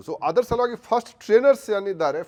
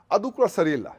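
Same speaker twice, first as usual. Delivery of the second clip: ordinary talk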